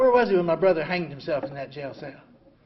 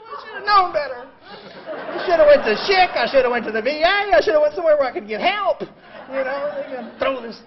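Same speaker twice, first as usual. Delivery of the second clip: higher, louder ranting at the hospital; retold fury; 7 semitones higher, 8 dB louder than usual. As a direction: vent the old rage with a laugh in it. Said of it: "Oh, I was furious".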